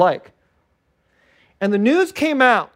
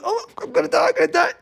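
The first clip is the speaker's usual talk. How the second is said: mock panic; 11 semitones higher, faster than usual. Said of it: "while you're totally".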